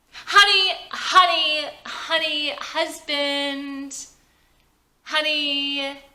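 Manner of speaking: high, loud, sing-song calls of honey; tipsy wife pestering her husband, sing-song. Acted. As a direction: drunk and clingy, calling for attention. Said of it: "Vicky is tipsy now".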